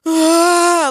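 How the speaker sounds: sudden high yelp of surprise; sharp imitated child's cry; mock startled cry. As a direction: yelp like you've been struck. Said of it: "he let out this, like".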